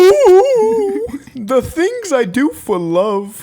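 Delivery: very high, slow cartoon voice; cartoon impersonation. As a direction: ham up a swooning cartoon character. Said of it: "can we do cartoon impersonations right now".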